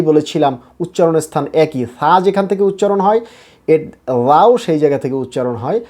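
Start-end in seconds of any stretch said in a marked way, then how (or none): none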